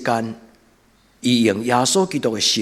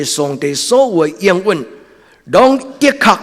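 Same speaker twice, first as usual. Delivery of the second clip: loud, high, impassioned sermon; fiery preaching; 9 semitones higher, 7 dB louder than usual. Acted. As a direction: preach it out at full force. Preaching at full force.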